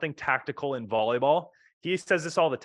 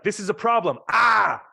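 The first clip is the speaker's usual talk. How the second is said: hammed-up shout, much higher and louder; mock shout; 8 semitones higher, 7 dB louder than usual. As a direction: blurt out the problem in a mock yell. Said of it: "You need to be like".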